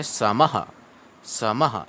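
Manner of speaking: समः with a quick ha at the end; visarga as ha. Shown as a demonstration. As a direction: say समः as sama-ha, the ha fast. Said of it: "ऐसे आप अभ्यास करें".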